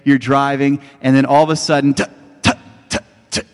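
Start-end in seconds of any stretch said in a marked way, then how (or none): 2.0-3.5 clicky voiceless tut tut of a dying engine; unvoiced tutting engine splutter; sputtering engine. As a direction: mimic the car coughing out. Car sputtering out of gas.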